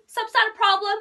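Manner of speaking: high, loud American challenge; sassy American accent. Acted. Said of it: "I feel like the American accent".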